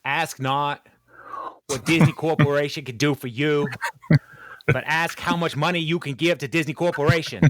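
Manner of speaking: mock Kennedy oration; Kennedy accent. Mocking Disney in a speech, hammed-up Kennedy accent. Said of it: "And my accent's terrible".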